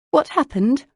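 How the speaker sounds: high, loud, eager question. A narrator's reading. Lucy sits down beside Jane wanting news, eagerly. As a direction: press a friend eagerly for the news.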